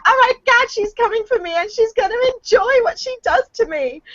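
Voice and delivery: high, squealing pretend dread; hammed-up fearful imitation; mock panic. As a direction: squeal it like cornered prey. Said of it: "I'm like".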